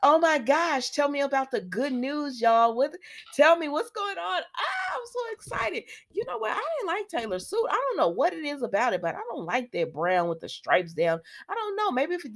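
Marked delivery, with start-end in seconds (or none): 0.0-7.7 high, gushing mock excitement, rising to a squeal. Host gushes as Taylor craving the good news, rising to a high squeal. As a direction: play up her eagerness. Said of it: "I'm making fun of Taylor".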